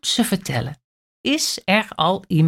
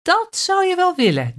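much higher, fast cheeky retort; cheeky thought; 12 semitones higher, faster than usual. A narrator's reading. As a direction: tease the crocodile in a quick high voice. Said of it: "denkt kleine aap". (Kleine Aap denkt brutaal, hoog en vlug)

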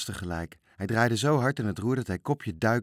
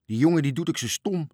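higher, louder grumble; exasperated; 7 semitones higher, 5 dB louder than usual. A narrator's reading. Van Dommelen complains about Toontje's sailing, exasperated. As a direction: complain at the boy's stupidity with a raised voice.

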